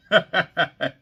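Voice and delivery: short laugh at own slip; embarrassed chuckle. Real, not acted. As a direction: laugh it off, a bit sheepish.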